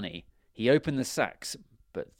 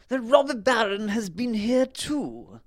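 high, loud king voice; story king; 12 semitones higher, 7 dB louder than usual. A narrator's reading. King tells his guests of the baron, high loud voice. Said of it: "said the king".

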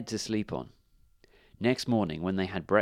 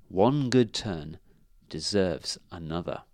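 ordinary delivery, nothing marked

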